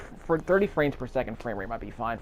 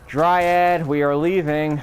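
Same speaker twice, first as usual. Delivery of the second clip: loud, higher-pitched call to a companion; 5 semitones higher, 7 dB louder, much slower than usual. Real, not acted. Player summoning the dryad to leave, calling out loudly.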